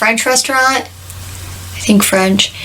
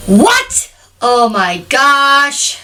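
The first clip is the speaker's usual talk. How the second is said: high, soaring "what", then a drawn-out reaction; shocked disbelief; 4 semitones higher, much slower than usual. Real, not acted. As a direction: react as if scandalized, stretching the words slowly. Shocked friend never saw Balto.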